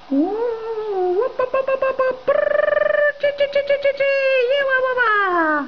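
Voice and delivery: high yipping party whoops. Acted. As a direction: whoop and chatter like tiny party dogs. Fiesta party noises.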